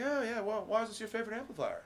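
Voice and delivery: mock British voice that slips toward Australian; bad British accent. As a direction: do a horrible British accent, pitched high. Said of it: "That's my horrible British accent".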